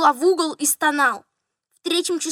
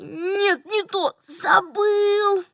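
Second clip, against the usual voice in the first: distressed recall; 5 semitones higher, much slower than usual. A narrator's reading. Steward fails to recall name.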